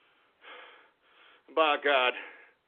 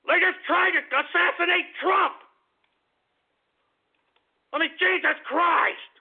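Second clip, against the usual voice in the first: high, outraged outburst; angry; 9 semitones higher than usual. Real, not acted. Host outraged they tried to assassinate Trump, angry. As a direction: cry it out in disbelief, drawing out the oath. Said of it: "I'm a little angry".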